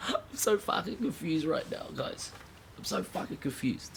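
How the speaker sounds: high, whimpering fake sob; mock crying. Ranter fakes tears over breaking scene confusion. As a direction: whine it like a hammed-up breakdown.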